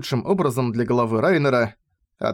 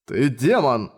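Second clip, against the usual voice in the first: usual speaking voice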